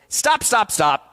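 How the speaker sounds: loud, high-pitched stop call; imitated director halting a take; abrupt interruption. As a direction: cut the take off loudly, starting high.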